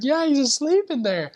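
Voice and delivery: high, bright, teasing insistence; giddy insistence. Retold or imitated. Insisting to companion he's asleep: high teasing giddiness. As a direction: squeak it up, unable to believe it.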